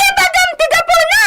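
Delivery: shouted, furious demand; angry shout. Acted. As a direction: daughter shouting at the maid to speak up, angry. Daughter repeats demand, shouting.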